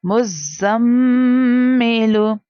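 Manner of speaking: मुज़्ज़म्मिलू with the doubled meem held nasally; with ghunna. Shown as a demonstration. Teacher demonstrates ghunna on the doubled meem, nasal hum. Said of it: "so we have to do गुन्ना".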